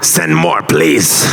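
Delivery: low, heavy bad-guy voice dropping very low on please; deadpan cartoon threat; villain voice. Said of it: "one villain voice".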